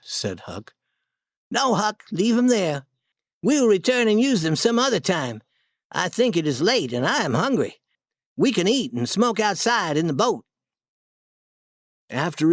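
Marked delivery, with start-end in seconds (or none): 1.5-10.5 put-on higher boyish voice, matter-of-fact; a hint of a boy taking charge; lightly voiced Tom giving orders. Tom decides about the guns.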